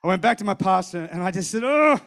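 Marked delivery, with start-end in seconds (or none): 1.6-2.0 loud, higher groan of reluctance; exasperated "oh"; reluctant groan. Retold or imitated. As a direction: groan at having to hand it over. Reluctant groan at giving money.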